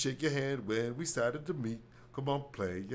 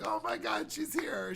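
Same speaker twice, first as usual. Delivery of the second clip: much higher, breathless excitement; mock excited gasp; 11 semitones higher than usual. Audience reacts to Doctor Phil's wife arriving, high mock excitement. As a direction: gasp like starstruck fans. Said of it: "everyone's like".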